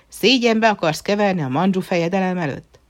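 furious father demanding an answer; angry shout. Acted. Emperor berating his son, loud and indignant. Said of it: "kiáltotta a császár".

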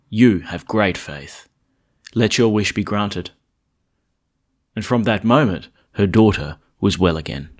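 loud, measured reading from the ambo; gospel proclamation. Acted. Priest reading the Gospel aloud to the congregation, loud and measured.

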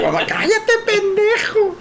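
high-pitched mock scolding; exasperated mother cutting off the act; mamá regañando. (regaño agudo imitado)

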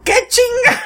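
high, loud startled outburst with laughter; shocked dad. Retold or imitated. Dad opens the car door, shocked outburst. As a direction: blurt it out in shock.

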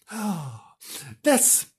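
ordinary delivery, nothing marked

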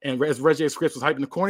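normal talking voice

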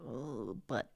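soft, mock-reluctant foot-dragging; half-whispered stalling, acted out. Gardener voicing the dread before showing up, mock reluctant. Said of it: "that precursor feeling of".

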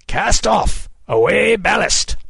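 high, loud, drawn-out captain's shout; bellowed command. A narrator's reading. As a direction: bellow orders across the deck. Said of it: "He turned and bellowed".

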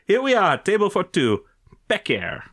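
mock French accent, high then dropping on the name; French maître d'. Maître d' reading out Peter's booking, mock French accent. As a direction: announce it as a snooty French waiter.

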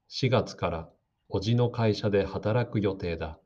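ordinary talk, nothing marked

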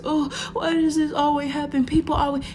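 high, whiny mock lament; mock boohoo crying. Woman retelling crying alone in her room, mock boohoo. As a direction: imitate your own tearful complaining. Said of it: "boohoo crying because".

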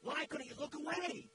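half-acted begging of the gang leader; pleading. Gang OG pleading with Matt and Little John, retold plea. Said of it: "He was pleading with us".

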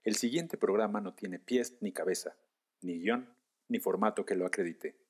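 quiet, solemn disclaimer voice; formal disclaimer. Acted. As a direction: read it like a formal warning. (aviso formal y solemne)

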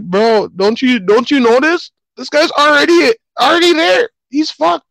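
very high, loud mocking voice; high-pitched god voice. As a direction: squeal it high and smug. Beerus voiced in retelling.

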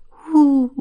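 high, drawn-out "hoooo"; wind howl. A narrator's reading. Wind springs up among the deer, high howl. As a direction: imitate a rising gust with a high hoot. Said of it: "زوزه کشید".

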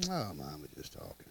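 put-on low, soft dismissive kid aside; mock kid mutter. Kids muttering about mama, low and dismissive. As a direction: brush it off under your breath. Kids mocking mama's threats.

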